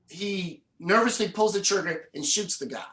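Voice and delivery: usual speaking voice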